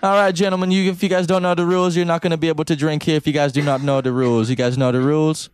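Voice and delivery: light imitation of a Jamaican accent announcing rules; Jamaican bar guy. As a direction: lay down the rules like the Jamaican bartender. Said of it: "He was like".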